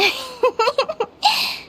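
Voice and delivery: a short amused giggle; light laugh. Real, not acted. Laughs at own self-promotion.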